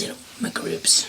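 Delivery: whispered echo, barely voiced; a sly little whispered aside. Real, not acted. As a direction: breathe the words out softly. Echoing ribs in a whisper.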